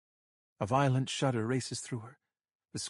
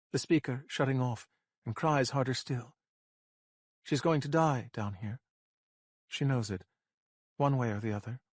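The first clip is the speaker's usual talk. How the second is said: plain reading voice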